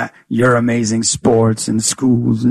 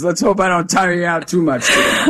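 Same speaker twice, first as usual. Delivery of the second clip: ordinary delivery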